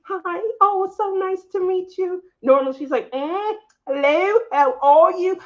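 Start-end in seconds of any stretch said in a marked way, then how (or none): 0.0-2.2 high, sugary hello; much higher, overly sweet nice-to-meet-you; sweet polite greeting. Retold or imitated. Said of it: "when she was so sweet". 3.1-5.4 high, slow snooty hello; stuck-up, drawn-out hello; hoity-toity. Retold or imitated. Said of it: "her nose is up here, hoity-toity".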